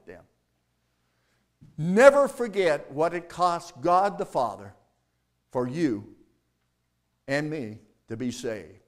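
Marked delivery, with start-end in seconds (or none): none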